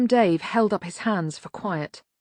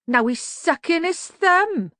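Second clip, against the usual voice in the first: high, slow sing-song mockery; childish jeer; 11 semitones higher, 4 dB louder, slower than usual. A narrator's reading. Cat's Eye mocking the burned man, high jeering. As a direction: jeer it like a playground bully. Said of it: "Cat's Eye jeered".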